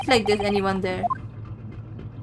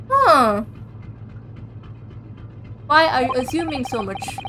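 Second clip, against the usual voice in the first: ordinary talk, nothing marked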